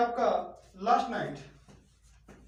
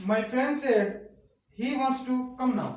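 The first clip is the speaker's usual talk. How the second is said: slow dictation; 2 semitones higher, 4 dB louder, much slower than usual. Shown as a demonstration. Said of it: "जैसे आपका एक example है".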